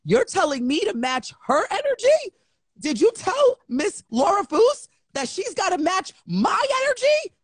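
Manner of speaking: high, rising incredulous comeback; indignant disbelief. Retold or imitated. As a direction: challenge them in a high, outraged squeal. Acted indignant retort to him.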